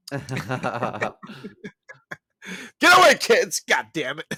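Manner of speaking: hammed-up exasperated shout at the kids; mock yell. Dad chasing off hungry kids, mock angry yell.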